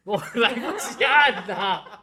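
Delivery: high, loud laughing protest. Real, not acted. Laughs about a missed button.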